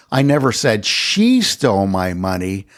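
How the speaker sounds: single out "she", then settle for "stole my money"; stress on SHE. Shown as a demonstration. Lecturer showing how stress changes meaning, stress on "she". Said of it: "Depending on stress emphasis".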